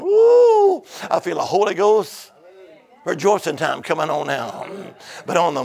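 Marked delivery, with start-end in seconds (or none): 0.0-0.8 high, loud "woo"; exultant whoop. Real, not acted. Preacher feeling the Spirit, exultant whoop.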